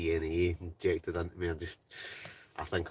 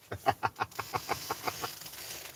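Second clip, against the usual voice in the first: quiet breathy laugh; 4 semitones higher, 8 dB quieter than usual. Real, not acted. Laughing at a chat comment.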